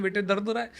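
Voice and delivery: a hint of a fussing mother or father; imitating a worried parent. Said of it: "वो कह सकते हैं". (हल्की सी नकल, चिंतित माता-पिता की आवाज़ में)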